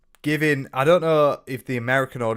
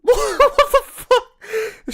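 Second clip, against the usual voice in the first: very high, loud, drawn-out laughing disbelief; helpless laughter; 8 dB louder, much slower than usual. Real, not acted. Streamer hearing yet more Argos praise, laughing helplessly. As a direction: crack up while getting the words out.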